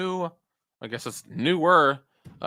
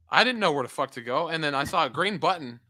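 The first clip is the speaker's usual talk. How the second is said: normal talking voice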